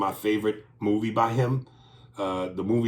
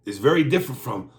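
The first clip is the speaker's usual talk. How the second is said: usual speaking voice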